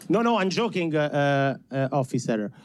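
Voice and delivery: ordinary talk, nothing marked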